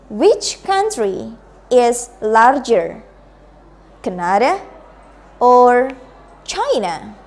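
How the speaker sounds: slow, with "Canada" rising and "China" falling; slow choice-question intonation. Shown as a demonstration. Teacher modelling for the class, slow rise then fall. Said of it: "listen to me and then you can repeat".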